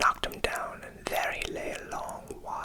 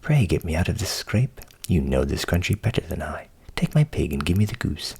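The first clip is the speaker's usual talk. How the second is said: louder, urgent plea; crying out; 8 semitones lower, 8 dB louder than usual. Acted. Frightened Hans begging the countryman, crying out. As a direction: plead loudly to be helped out. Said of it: "cried he".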